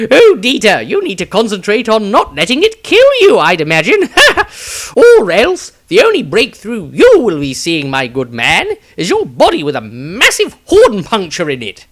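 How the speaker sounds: high, loud laughing wizard voice; mock him through laughter; amused wizard. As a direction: wizard teasing the fighter. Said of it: "Maestro laughed at this".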